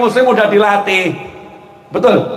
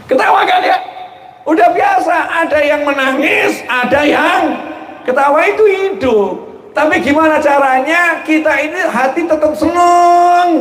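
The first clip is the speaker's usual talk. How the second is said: preaching at full force; 9 semitones higher than usual. Acted. Preacher rousing the congregation about life's tears and laughter, high slow forceful preaching. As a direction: drive the point home to the hall.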